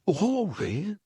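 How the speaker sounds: high, bright hooray; joyful cheer. Acted. Frog cheers while flying over the moors, joyful.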